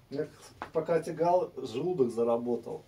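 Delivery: ordinary talk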